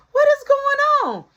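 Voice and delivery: very high, loud demanding shout; mother bursting in on the commotion, shouting; shrieking mom. Retold or imitated. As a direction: demand what's going on. Mom coming in on yelling.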